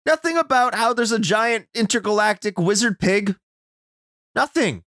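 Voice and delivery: high-pitched exasperated complaint; worked-up, indignant recap; incredulous rant. Real, not acted. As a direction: push the pitch up in disbelief. Incredulous rant about missing plot.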